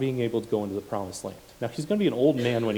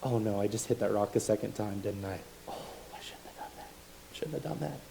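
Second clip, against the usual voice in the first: softer, fading to a whisper; regretful inner voice; 8 dB quieter than usual. Retold or imitated. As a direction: mutter the regret to yourself. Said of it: "had a thought of like".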